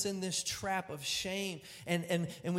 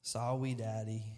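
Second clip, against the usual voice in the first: soft, lower, timid sorry; ashamed little girl; 8 semitones lower, 5 dB quieter than usual. Retold or imitated. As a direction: say it small and ashamed. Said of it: "she looks up at me".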